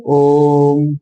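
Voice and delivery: "ओम" with the O held long and level; low, sustained "ओम", chant-like; drawn-out vowel. Shown as a demonstration. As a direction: stretch "ओम" out slowly. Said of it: "ओ को थोड़ा लंबा खींच रहे हैं".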